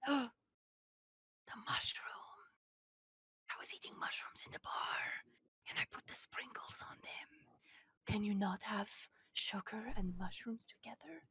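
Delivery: hushed worried whisper. Acted. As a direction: whisper it in alarm. Character panics secretly.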